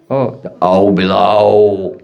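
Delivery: low, slow, drawn-out bogeyman name; mock-ominous low drone; spooky warning. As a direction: stretch it out ominously to scare a child. Mother scares child with bogeyman.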